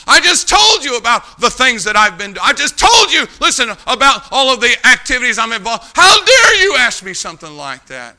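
hammed-up exasperated rebuke; imitated angry outrage. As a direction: act out an affronted deacon shouting. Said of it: "he got so angry with me".

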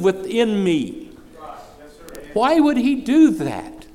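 loud, high, shouting preacher's cadence; fiery preaching. Acted. Preacher to congregation, fervent loud preaching. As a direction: preach it at full force to the congregation.

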